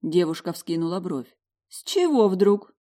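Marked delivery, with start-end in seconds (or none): 1.7-2.7 slow, doubtful challenge; skeptical. A narrator's reading. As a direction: raise an eyebrow in your voice. Karina, raised eyebrow.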